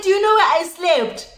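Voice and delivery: high-pitched retold confrontation; mock-outraged question; indignant demand. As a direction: ask it high, demanding an answer. Retold confronting friend after bad night.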